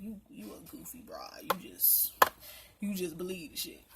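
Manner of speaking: muttered put-down. Retold or imitated. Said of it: "I'm like".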